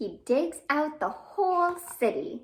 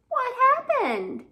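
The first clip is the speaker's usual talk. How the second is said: much higher, louder surprised question; excited question; 12 semitones higher, 7 dB louder, slower than usual. Acted. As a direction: ask it with big alarm. Asking the worm in the rhyme.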